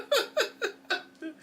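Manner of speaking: high, pealing run of laughs; laughter. Real, not acted. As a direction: break into high-pitched giggling. Laughing while showing the snack.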